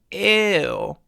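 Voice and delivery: heavy vocal weight, disgusted; emphatic ew. Shown as a demonstration. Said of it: "emphatic ew sound".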